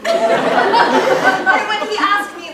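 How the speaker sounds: very slow, loud, laughing through the words. Acted. Olga recalling the Baron's proposal, laughing through the words. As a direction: laugh helplessly while telling it.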